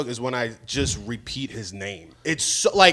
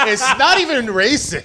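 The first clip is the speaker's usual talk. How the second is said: higher, louder mock disclaimer through laughter; loudly teasing echo; mocking imitation; 10 semitones higher, 5 dB louder than usual. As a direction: parrot it back, laughing. Mimics the other's disclaimer, laughing.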